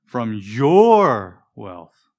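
"your" swelling loud and long, "wealth" falling off after it; stress on YOUR. Shown as a demonstration. Preacher offering the congregation another reading choice, stress on "your". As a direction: lean hard on "your" and stretch it out. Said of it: "my own oral reading version".

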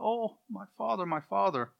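high, plaintive mock cry. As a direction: cry out for a lost mentor, without shouting. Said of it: "crying out".

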